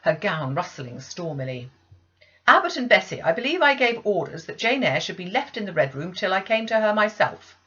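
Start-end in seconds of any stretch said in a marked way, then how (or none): 2.5-7.6 stern rebuke. Acted. Mistress rebuking servants.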